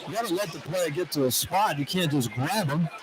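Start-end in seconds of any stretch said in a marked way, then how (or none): none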